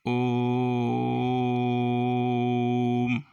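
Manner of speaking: ओ held extremely long before the final म; ओ prolonged in ओम. Shown as a demonstration. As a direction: sustain ओम on one unwavering note. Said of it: "ओ को हम काफ़ी देर तक बोलते हैं".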